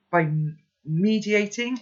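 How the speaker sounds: "mediating" said for meditating; misread word. Shown as a demonstration. Reader misreading meditating as mediating. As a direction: stumble into "mediating". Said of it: "I meant to say meditating".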